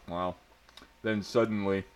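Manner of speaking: ordinary delivery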